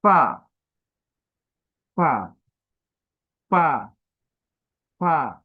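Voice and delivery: ফা said loud with teeth on lip; crisp fa. Shown as a demonstration. Teacher demonstrating the letter to students, loud. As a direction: bite the lip and puff ফা. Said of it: "সামনের দাঁতটা এই আমাদের ঠোঁটে লাগবে".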